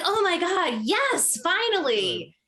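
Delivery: high-pitched mock elation; delighted fans. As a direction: squeal it like an overjoyed follower. Imitates delighted Twitter followers.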